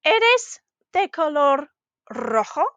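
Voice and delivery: slow "Eres de color rojo", pausing before "rojo" and turning up at its end; "Eres de color rojo" with an upturn on the last syllable; rising question. Shown as a demonstration. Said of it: "our voice was going up at the end".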